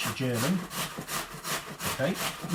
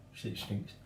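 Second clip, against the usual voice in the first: whispered aside; 5 semitones lower, 10 dB quieter than usual. Real, not acted. Aside about wife's dislike.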